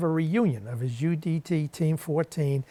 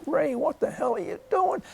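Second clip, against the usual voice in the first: much higher calling-out yell up the driveway; retold yell; 14 semitones higher than usual. Friend yelling at Ray in pajamas, raised alarmed call. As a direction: call after him in alarm. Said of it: "I yelled out".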